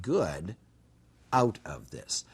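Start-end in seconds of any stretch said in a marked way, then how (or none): none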